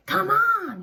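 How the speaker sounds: high, loud invitation; eager coaxing. Acted. The anger creature coaxes On eagerly. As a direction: tempt him out.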